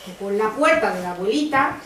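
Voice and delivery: ordinary delivery, nothing marked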